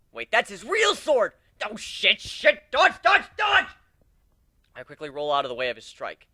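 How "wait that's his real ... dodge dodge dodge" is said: panicked yelling. A narrator's reading. Panicking as real sword comes.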